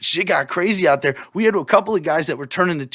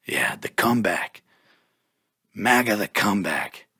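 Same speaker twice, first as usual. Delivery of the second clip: whisper; 6 semitones lower than usual. Pretend. Teasing a Trump comeback slogan.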